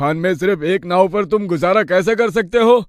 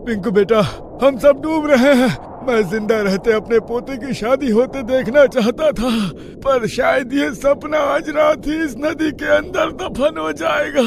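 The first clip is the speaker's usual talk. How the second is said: high, anguished lament; despairing; 6 semitones higher than usual. Acted. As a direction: grieve as the water rises. Grandfather drowning, last words.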